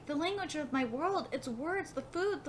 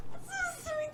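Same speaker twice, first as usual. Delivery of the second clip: very high, small coo; touched gushing; 13 semitones higher, 4 dB quieter, slower than usual. Real, not acted. Reader melting at Water God's good night, cooing. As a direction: squeak it out, utterly melted.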